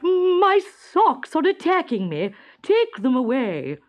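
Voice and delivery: high, loud, slow hammed-up terror; a frightened king crying out; panicked. A narrator's reading. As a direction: beg to be saved from the socks. King panicking under socks.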